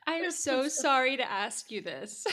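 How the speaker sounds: hammed-up apologetic plea, drawn out; awkward apology. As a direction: play it as a cringing, slowed-down request. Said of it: "asked her really awkwardly".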